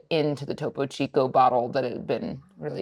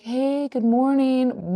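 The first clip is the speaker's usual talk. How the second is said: cheery greeting; 8 semitones higher, much slower than usual. Retold or imitated. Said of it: "was just like".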